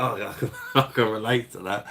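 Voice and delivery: laughing through the retold line; retelling his own reply with a laugh. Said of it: "I was like".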